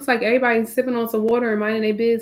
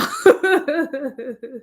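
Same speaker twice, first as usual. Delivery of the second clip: laugh; 4 semitones higher, 4 dB louder than usual. Real, not acted. Laughs at her own joke.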